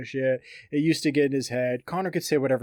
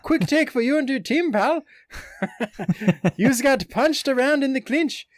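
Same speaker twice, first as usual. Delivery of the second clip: much higher, louder mock Irish accent, laughing through it; 11 semitones higher, 6 dB louder than usual. Host reading McGregor's tweet to co-host in a laughing mock Irish accent. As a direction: ham up a giggly Irish brogue. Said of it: "I really wish I could do this in an Irish".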